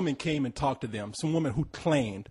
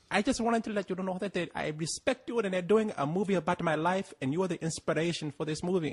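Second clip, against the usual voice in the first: higher-pitched, rough imitation of an Ethiopian accent; attempted Ethiopian accent; 6 semitones higher than usual. Ethiopian woman addressing Spike Lee at a talk, imitated Ethiopian accent. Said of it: "I can't really do the accent".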